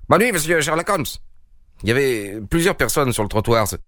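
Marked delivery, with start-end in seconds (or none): 0.1-1.0 a lightly voiced young passer-by calling out higher; called out by a passer-by. A narrator's reading. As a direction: toss a quick goodnight from the street. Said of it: "un passant lui lança".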